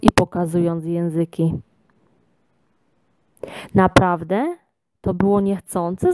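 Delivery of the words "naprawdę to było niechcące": plain reading voice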